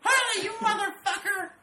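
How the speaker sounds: high, full-force yell at a misbehaving dog; screamed scolding. Retold or imitated. Mocking the owner yelling at the dog, screamed scolding. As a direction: scream the name, drag out the curse.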